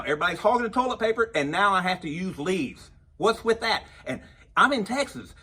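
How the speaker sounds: comic ranting at the viewers; worked-up rant. Acted. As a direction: work yourself up over the toilet paper. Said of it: "I'm getting all excited about this".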